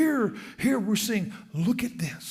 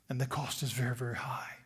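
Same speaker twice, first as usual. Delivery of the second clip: soft lower whisper; hushed warning; 6 semitones lower, 6 dB quieter than usual. Real, not acted. Preacher warning the congregation of the cost, hushed. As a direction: drop to a whisper to warn. Quieting for emphasis.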